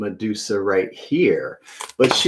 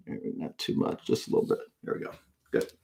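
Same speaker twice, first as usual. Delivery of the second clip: whispered to himself; whispered aside; 13 dB quieter than usual. Real, not acted. Adjusting the lamp on stream, whispered to himself. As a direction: murmur it under your breath.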